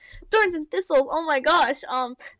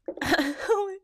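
high laugh; 7 semitones higher than usual. Real, not acted. Laughs at quote.

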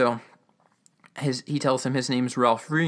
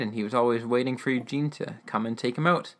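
ordinary talk

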